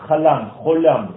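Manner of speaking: slow, « chalam » with a, then « cholam » with o; contrast chalam and cholam. Shown as a demonstration. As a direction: set the two vowels apart slowly. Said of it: "je vous parle ici de cholam". (lentement, opposez le a de « chalam » et le o de « cholam »)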